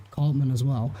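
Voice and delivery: usual speaking voice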